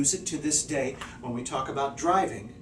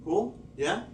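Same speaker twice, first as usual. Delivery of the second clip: normal talking voice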